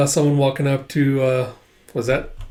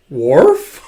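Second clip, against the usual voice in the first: loud woof rising like a question; questioning dog bark; 7 dB louder than usual. Retold or imitated. Bond answering the sniffing dog, questioning bark. Voices dog's puzzled bark.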